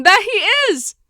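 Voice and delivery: high, loud burst of recognition; excited sighting. Retold or imitated. Vlogger reliving spotting her dream man in the kitchen, excited. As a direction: exclaim as if he just appeared.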